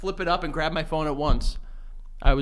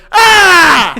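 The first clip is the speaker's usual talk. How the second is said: very loud, high mock scream; imitated old Russian's scream; 16 dB louder than usual. Retelling a TikTok of an old Russian guy, screaming. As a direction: scream out as the man in the video. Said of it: "some old Russian guy, and he goes".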